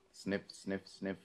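quiet exaggerated sniffs; sniffing the air. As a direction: sniff hard and slow. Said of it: "Do it like you're sniffing panties".

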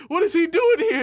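high, loud disbelief; hammed-up astonished question; mock shock. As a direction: exclaim it high like a startled onlooker. Acts out startled crowd reaction.

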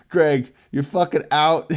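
loud, jokey call-out at his brother; mock shout. Shouting at his brother Greg to get out, loud and joking. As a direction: bark the name like kicking him out.

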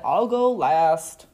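low, slow bus voice; double-decker bus. A narrator's reading. Bus to the other vehicles, low and slow. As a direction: draw it out slowly and low. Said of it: "the double-decker bus said".

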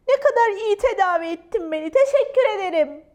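high, loud, fast gush of thanks; delighted unicorn voice; joyful. Acted. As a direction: gush happily at top speed. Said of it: "dedi neşeyle".

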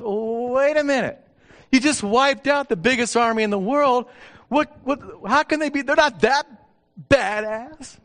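high, loud disbelieving protest; incredulous protest. Retold or imitated. Preacher acting out his protest to God, loud and high. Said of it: "now here's my response".

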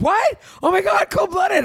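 high-pitched playful outrage; mock shock. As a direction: act scandalised, voice shooting up high. Acting out his shocked, joking reply.

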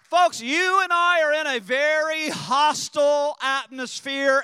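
loud, high call to the church; shouted warning. Real, not acted. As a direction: warn the congregation at full voice.

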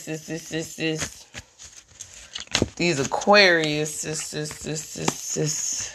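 fading whisper. Real, not acted. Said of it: "trailing chant".

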